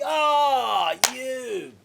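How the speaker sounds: high, loud wounded cry; mock outrage. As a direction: howl it as if stung by an insult, hammed-up. Retold outrage at mum's jab.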